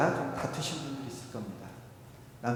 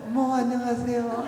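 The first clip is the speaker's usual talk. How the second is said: sweet greeting; 7 semitones higher than usual. Retold or imitated. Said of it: "모드로 바뀝니다".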